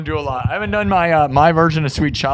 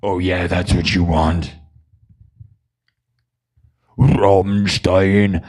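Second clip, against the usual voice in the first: very low, slow metal-singer voice; 10 semitones lower, slower than usual. Pretend. Teasing chat about their Rammstein request, deep metal voice. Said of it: "But I can sound metal".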